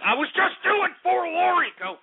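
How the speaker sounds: high, sudden holler; exasperated outburst. Real, not acted. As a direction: burst out high in protest. Exasperated excuse to critics.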